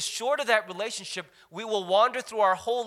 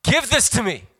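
eager seeker; 4 semitones higher, 9 dB louder than usual. Retold or imitated. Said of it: "this person goes".

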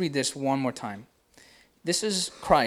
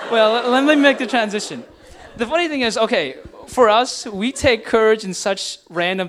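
louder, higher words still full of laughter; laughing transition; 6 semitones higher, 8 dB louder than usual. Real, not acted. Pastor laughing as he steers back to the point. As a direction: push on through the giggles.

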